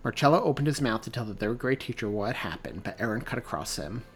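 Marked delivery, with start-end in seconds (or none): none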